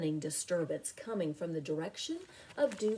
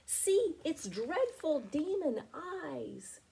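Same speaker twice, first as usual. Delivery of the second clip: frightened, slow and higher; fearful, high and slow; 9 semitones higher, slower than usual. A narrator's reading. Deinonychus fearing the demon eyes, slow and high. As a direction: quaver out the dread slowly. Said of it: "cried the Deinonychus".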